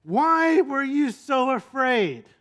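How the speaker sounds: Jesus rebuking frightened disciples; exasperated teacher. Retold or imitated. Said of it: "notice how Jesus responded".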